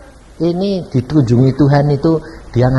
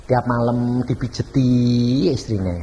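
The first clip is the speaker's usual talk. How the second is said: ordinary talk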